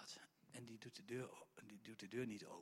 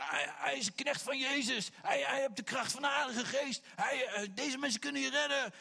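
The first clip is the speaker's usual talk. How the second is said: loud crying out; 12 semitones higher, 11 dB louder than usual. Retold or imitated. The possessed slave girl following Paul and Silas, calling high and loud. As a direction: shout it after them in the street.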